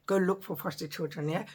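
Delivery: usual speaking voice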